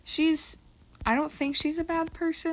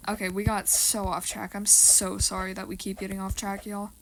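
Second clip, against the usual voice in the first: ordinary talk, nothing marked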